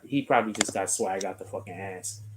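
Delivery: normal talking voice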